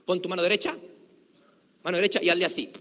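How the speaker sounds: ordinary talk, nothing marked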